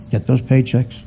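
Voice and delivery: ordinary talk, nothing marked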